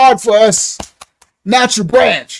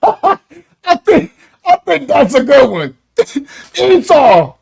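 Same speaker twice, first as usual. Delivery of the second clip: laughing through the words; tickled, laugh-broken delivery; real amusement; 2 semitones higher, slower than usual. Real, not acted. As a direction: crack up at your own joke. Cracks up at own nickname joke.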